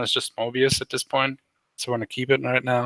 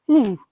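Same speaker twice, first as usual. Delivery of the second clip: short high-pitched hoot; excited owl hoot; 12 semitones higher, 4 dB louder than usual. Acted. Otto the Owl pauses mid-message, high excited hoot. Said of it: "You sound a little too excited".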